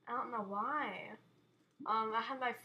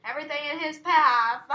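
loud, hammed-up outrage; dramatic shout; 3 semitones higher, 16 dB louder than usual. Gamer watching his killer destroy everything, dramatic shout. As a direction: shout it out theatrically.

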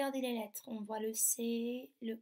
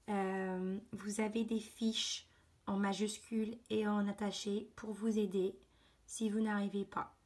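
ordinary delivery

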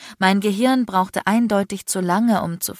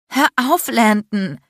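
higher, louder, hurt cry; distressed plea; 5 semitones higher, 6 dB louder, slower than usual. A narrator's reading. Tearful woman tells the man to stop, distressed. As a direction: beg urgently for it to end.

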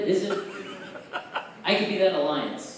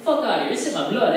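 proud homie claim, louder; mock Mexican accent; 4 semitones higher, 4 dB louder than usual. Comic claiming Mexican blood, mock accent. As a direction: declare it with chest out. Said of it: "I say".

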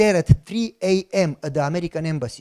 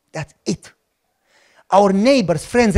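normal talking voice

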